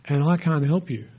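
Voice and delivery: low, soft, deflated; crushed. Retold or imitated. Preacher voicing someone drained by complaints, deflated. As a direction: sound flattened by someone's troubles.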